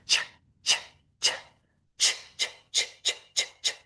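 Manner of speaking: unvoiced ch, repeated crisply; a breathy voiceless ch over and over; ch sound. Shown as a demonstration. Teacher demonstrating voiceless ch for students. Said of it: "say the C H sound".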